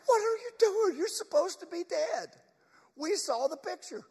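high-pitched, incredulous acted voice; shocked friends. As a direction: protest in a high voice, unable to believe it. Acts shocked friends of Lazarus.

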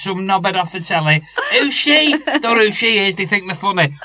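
loud sneering troll rant, hammed-up; mock Twitter troll. Podcaster mocks Twitter trolls, loud sneering rant. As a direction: rant like an angry keyboard warrior. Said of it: "You know what Twitter's like".

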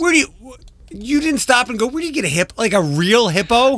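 high, disbelieving question; incredulous. Real, not acted. Incredulous question about hippo.

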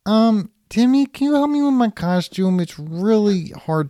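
put-on high, small boy voice; little kid voice.

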